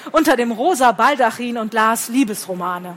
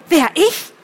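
surprised; 6 semitones higher, 4 dB louder than usual. A narrator's reading. Millie überrascht.

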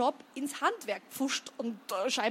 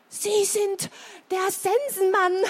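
put-on high-pitched awed old-lady gasp of words; aghast, high breathed recognition; breathed, toneless; 8 semitones higher, 5 dB louder, slower than usual. A narrator's reading. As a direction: breathe it out in high, stunned horror. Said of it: "hauchte Misses Sandringham tonlos".

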